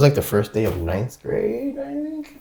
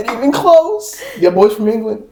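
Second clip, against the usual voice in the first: high-pitched, laughing retort; amused mock quote; 14 semitones higher, 3 dB louder than usual. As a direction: joke through a laugh. Mocking his fake English identity.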